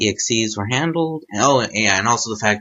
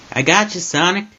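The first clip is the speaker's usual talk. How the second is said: higher, louder call to a friend; shouted; 7 semitones higher, 4 dB louder than usual. Acted. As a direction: call out to grab him in time. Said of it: "a voice shouted".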